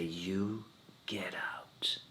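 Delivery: barely voiced, soft get out; a quiet imagined dismissal; hushed order. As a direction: whisper the order as if dreaded. Imagined teacher ordering him out.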